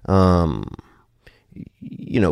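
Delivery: ordinary delivery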